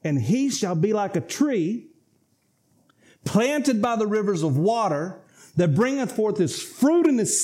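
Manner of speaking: preacher reading scripture aloud. Acted. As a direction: proclaim the King James words. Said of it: "Look what it says, verse three".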